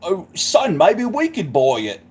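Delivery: mock-English old father talking to his son; broad Cockney grandpa put-on; English granddad accent. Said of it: "the dad's like".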